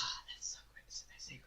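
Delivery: unvoiced, breathy whisper. Real, not acted. Host whispers a reaction while his cohost talks. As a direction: whisper it as an amused aside. Sarcastic aside.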